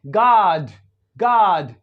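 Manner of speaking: गॉड loud with an open a, gahd; गॉड, wide open aa vowel; American god. Shown as a demonstration. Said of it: "इस तरह से pronounce होता है ओ in American English".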